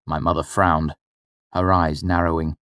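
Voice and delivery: plain reading voice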